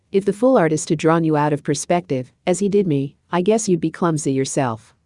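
usual speaking voice

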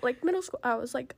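ordinary talk, nothing marked

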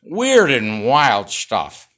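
slow, loud catchphrase again; stretched host catchphrase; Johnny Carson impression. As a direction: lean into it like the late-night host. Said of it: "There it is".

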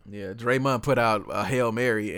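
ordinary talk, nothing marked

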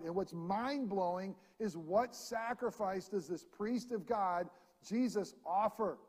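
full-force preaching. Acted. Pastor exhorting the church, preaching at full force. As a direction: preach it out to the congregation with rising fervor.

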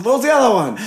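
usual speaking voice

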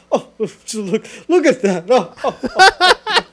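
imitating a tickled dad, loud and laughing; hammed-up dad cracking up at the screen; amused dad. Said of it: "her dad was just like".

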